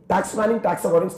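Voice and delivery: usual speaking voice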